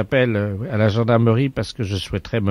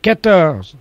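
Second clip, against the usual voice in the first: hammed-up lottery call-out; 8 semitones higher, 3 dB louder, slower than usual. Prank caller gives absurd birth date.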